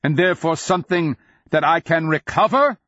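high, loud rhetorical question; ringing, incredulous call. A narrator's reading. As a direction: throw the challenge down from on high. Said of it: "the Nonman king called down".

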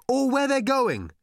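high-pitched shouted question; calling out. A narrator's reading. Arthur asking old man below, calling out high. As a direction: call it down from a height. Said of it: "he called".

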